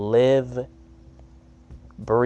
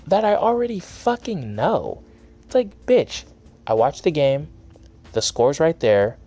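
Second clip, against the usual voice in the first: exasperated outburst; 6 semitones higher, 3 dB louder than usual. Real, not acted. Rant peaks at Stephen A Smith.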